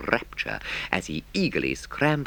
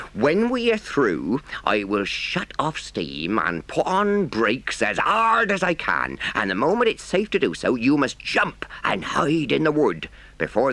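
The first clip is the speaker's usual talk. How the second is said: urgent country engine driver; higher, emphatic instructions in a rustic accent; 6 semitones higher than usual. A narrator's reading. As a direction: earnestly lay out the escape plan. Narrator voices engine driver.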